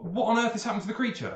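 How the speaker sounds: high-pitched alarmed questioning; startled sailor. Acted. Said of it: "comes down and says".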